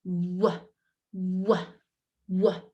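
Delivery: W with lips pushed together and rounded. Shown as a demonstration. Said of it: "Bring your lips together".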